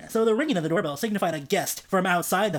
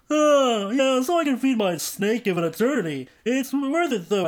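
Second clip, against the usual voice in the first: a hint of a cartoon caveman buddy; lightly voiced cartoon sidekick; Barney Rubble; 4 semitones higher, 4 dB louder than usual. A narrator's reading. Brother to brother, a touch of Barney Rubble. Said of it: "I think I'm doing Barney Rubble now".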